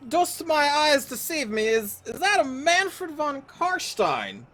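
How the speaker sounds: high, slow archaic proclamation; mock theatrical herald. Gamer greeting the returning vampire lord in mock archaic awe. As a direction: declaim it like a startled old knight.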